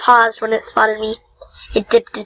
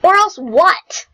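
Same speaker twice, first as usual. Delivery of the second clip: defiant challenge; 8 semitones higher, slower than usual. Acted. Defiant challenge in play fight.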